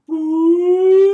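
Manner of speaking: long, loud, high sung boop; sung high note for the kids; high held boop. Shown as a demonstration. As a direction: slide way up and hold it. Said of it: "As our voice goes up".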